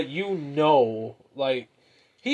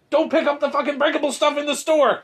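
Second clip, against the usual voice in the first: high, raised parental yell; exasperated parent voice, pitched way up; yelling at kids; 11 semitones higher, 3 dB louder than usual. Retold or imitated. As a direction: scold the kids from across the aisle. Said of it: "I yell at my kids".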